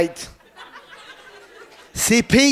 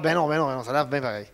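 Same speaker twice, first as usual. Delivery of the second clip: mock dismissive; 10 semitones lower, 9 dB quieter, faster than usual. Voicing his dismissive reply.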